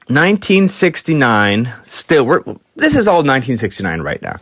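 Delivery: usual speaking voice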